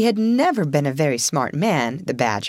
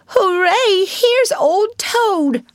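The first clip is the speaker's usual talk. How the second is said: high, loud, drawn-out cheer; joyful cry; 6 dB louder, much slower than usual. A narrator's reading. Mole seeing Toad back again, crying out joyfully. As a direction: cry out in beaming delight. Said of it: "cried the Mole, his face beaming".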